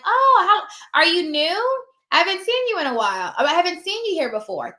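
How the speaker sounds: high, bright and a bit loud, easing down; friendly surprise. Shown as a demonstration. Notary chatting up a new receptionist, bright and high. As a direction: sound delighted to meet someone new. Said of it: "And I may something like".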